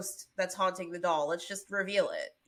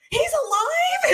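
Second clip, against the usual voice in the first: very high slow shocked question; stunned shriek; 9 dB louder, slower than usual. Retold or imitated. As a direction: gasp it out in disbelief. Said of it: "she looked up, she goes".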